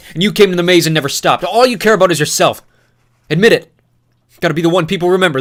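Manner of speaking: loud, high, relentless tirade; furious yelling. Acted. Newt yelling accusations at Thomas, furious and loud. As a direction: rage at him without letting up. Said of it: "spit flew from his mouth as he yelled".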